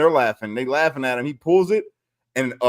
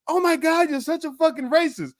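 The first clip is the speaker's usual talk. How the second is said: high-pitched scandalised imitation; mock offended women; 13 semitones higher than usual. Mimicking women on a live stream, high mock horror.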